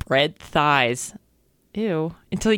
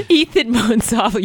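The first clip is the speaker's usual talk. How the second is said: laughing while reading; 4 semitones higher, 4 dB louder than usual. Real, not acted. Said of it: "rereading after laugh".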